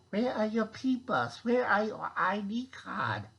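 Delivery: lightly hammed German checkpoint voice; bad German accent. Official checking ID cards, in a light bad German accent. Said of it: "a really bad German impression".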